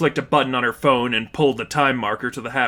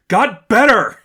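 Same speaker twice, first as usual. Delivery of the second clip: loud, much higher, choked with rage; furious shout; 9 semitones higher, 8 dB louder, slower than usual. A narrator's reading. Cassandra snapping back at Stephanie, furious shout. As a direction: spit it back at her. Furious retort after shove.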